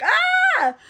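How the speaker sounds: short high shrieking scream; mock scream. [name] screaming at the shark attack, high mock shriek. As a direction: shriek in fake horror, pitch way up.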